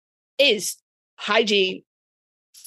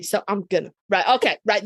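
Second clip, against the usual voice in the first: ordinary talk, nothing marked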